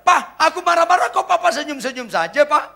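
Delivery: high, loud mock wife voice; imitating the nagging wife; wife's complaint. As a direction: play the wife, shrill-pitched whine. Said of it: "Istri saya bilang".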